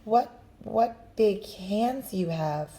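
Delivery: put-on slow, hesitant little girl voice; slow wondering girl. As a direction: drag it out, uneasy. Girl hesitates before the wolf.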